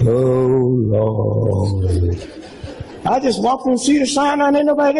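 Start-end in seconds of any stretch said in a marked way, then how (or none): 0.1-2.3 mopey, downcast mutter; hammed-up sad "oh Lord"; sad sigh. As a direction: drag in all mournful and low. Mocking a sad patient walking in.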